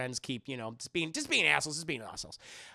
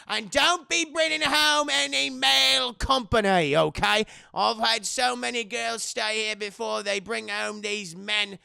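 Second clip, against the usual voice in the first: higher, louder, scolding rant; stern old landlady; 5 semitones higher, 5 dB louder than usual. Retold or imitated. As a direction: warn the new tenant fiercely. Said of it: "landlady lecturing rules".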